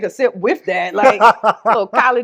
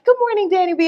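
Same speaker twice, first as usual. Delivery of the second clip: cheerful greeting; 10 semitones higher, faster than usual. Retold or imitated. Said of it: "she's like".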